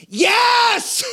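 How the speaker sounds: a high, shouted "Yes!" like someone hanging off a cliff; desperate yes. Retold or imitated. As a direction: yell "Yes!" with everything you've got, pulled up from the edge. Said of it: "a pronounced emphaticness to that yes".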